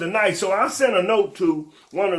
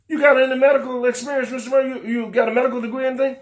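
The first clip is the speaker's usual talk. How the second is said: mocking board member; 5 semitones higher, faster than usual. Board mocking his application.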